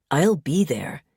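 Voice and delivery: plain reading voice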